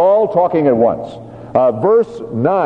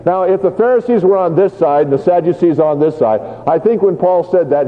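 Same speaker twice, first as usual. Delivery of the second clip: ordinary talk, nothing marked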